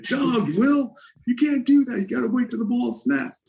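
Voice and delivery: high, mock-scolding voice of Charles; teammate scolding. Charles correcting Will at practice, high scolding voice. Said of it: "He said".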